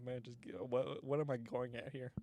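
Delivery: normal talking voice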